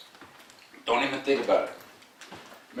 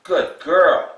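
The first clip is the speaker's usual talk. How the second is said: higher, louder praise for the dog; happy praise; 7 semitones higher, 8 dB louder than usual. Shown as a demonstration. Trainer shows owners how to praise the dog, upbeat. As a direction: reward her with bright praise. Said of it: "She goes to the ground".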